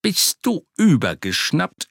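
high, startled cry of disbelief; shocked outburst. Acted. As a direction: blurt it out in shock. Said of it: "entfuhr es Socke".

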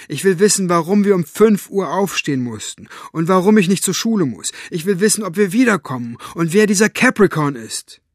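high, loud string of demands; defiant girl demanding answers; calling out. A narrator's reading. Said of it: "rief Maggie".

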